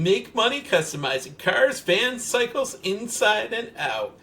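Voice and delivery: lifted, salesy slogan voice; ad pitch. Retold or imitated. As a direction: read it out like an eager sales pitch. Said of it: "full-page ad saying".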